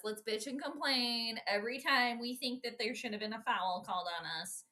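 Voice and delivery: normal talking voice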